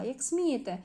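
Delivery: loud outraged protest, slowing at the end; indignant man refused entry, shouting at the ticket taker. Acted. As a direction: shout it like an offended gatecrasher. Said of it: "Фігура кричала".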